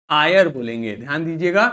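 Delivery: ordinary talk, nothing marked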